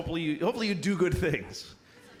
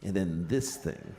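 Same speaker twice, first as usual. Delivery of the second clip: sly low voice; 12 semitones lower than usual. Shown as a demonstration. Showing the thought he doesn't believe, low and sly. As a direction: sink the voice for the bad thought. Demonstrates bad thought against good one.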